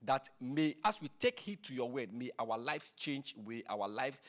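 soft, low prayer voice. Real, not acted. Preacher closing a prayer to the Lord, soft and low. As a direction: pray it quietly to God.

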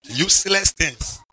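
ordinary delivery

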